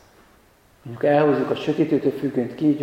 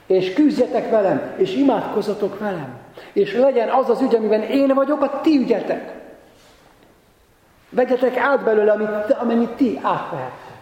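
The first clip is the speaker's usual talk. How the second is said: raised, high-pitched urgent appeal; impassioned preaching; 7 semitones higher than usual. Acted. Preacher voicing Jesus' call to his disciples, impassioned and high. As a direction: plead insistently, pitched well up.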